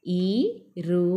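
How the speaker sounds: ordinary talk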